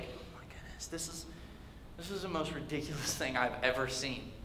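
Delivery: hushed, disbelieving aside; appalled whisper. Acted. Onlookers watching David shed his armor, whispering in disbelief. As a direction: start whispered, then very soft and low.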